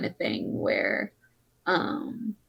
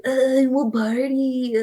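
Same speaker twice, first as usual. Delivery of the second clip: slow, dopey party-guy drawl; frat bro; 6 semitones higher, slower than usual. Retold or imitated. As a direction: play a lazy frat bro. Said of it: "that's my frat boy impression".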